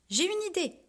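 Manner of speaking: high eager exclamation; crying out. A narrator's reading. Barbie telling friends her idea, high eager cry. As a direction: burst out with the idea. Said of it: "s'écrie Barbie".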